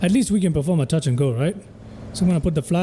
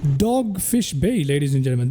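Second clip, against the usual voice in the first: slow, drawn-out captain announcement; pilot announcement; 4 semitones higher, 4 dB quieter than usual. Real, not acted. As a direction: announce the arrival slowly. Announces arrival to viewers.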